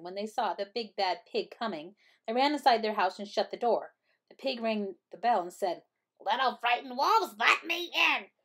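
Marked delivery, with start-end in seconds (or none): 6.2-8.3 pig voice. A narrator's reading. Big bad pig at the door.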